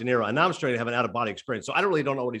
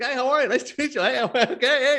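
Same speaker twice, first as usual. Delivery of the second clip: lightly voiced friendly uncle, pitched high; high-pitched, chummy uncle greeting; Robert De Niro; 9 semitones higher, 4 dB louder than usual. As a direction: greet like an avuncular star. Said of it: "He's just like an uncle".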